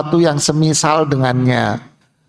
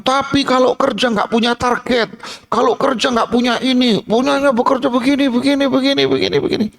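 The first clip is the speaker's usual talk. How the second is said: much higher, nagging female voice; complaining wife; 7 semitones higher than usual. Acted. Wife complaining to the ustadz, high and nagging. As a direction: whine about her husband's ways.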